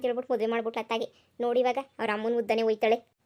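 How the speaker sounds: quiet village gossip; gossiping aside. Acted. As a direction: murmur it to the woman next door. Neighbour gossiping aside to another.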